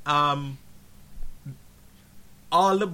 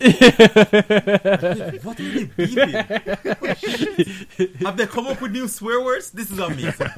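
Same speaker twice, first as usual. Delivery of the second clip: high, louder voice breaking up with laughter; laughing hard; 7 semitones higher, 10 dB louder than usual. Real, not acted. Host joking about new swear words, laughing hard. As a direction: talk through real laughter. Cracking up over beeped swearing.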